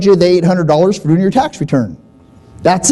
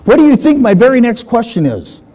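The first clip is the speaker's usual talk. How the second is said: normal talking voice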